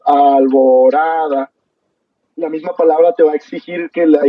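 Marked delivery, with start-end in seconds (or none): 0.0-1.5 "alborada" very slow, each syllable held long; clapping-rhythm demo. Shown as a demonstration. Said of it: "si utilizamos todos los aplausos". ("alborada" muy lento, alargando cada sílaba al ritmo de aplausos)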